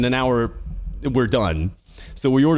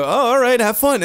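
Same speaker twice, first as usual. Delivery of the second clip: high, louder nervous brush-off; mild retold panic; 11 semitones higher, 5 dB louder than usual. Storyteller replays excusing himself from the drugs, mildly panicking. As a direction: imitate trying to slip away, flustered. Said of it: "kinda panicking when I go".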